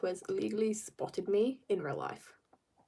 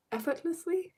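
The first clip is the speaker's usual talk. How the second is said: "effortlessly" higher, as a question; quicker, unsure "effortlessly?"; 7 semitones higher, 4 dB quieter than usual. Real, not acted. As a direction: check "effortlessly" with a high questioning lift. Checking own retry of word.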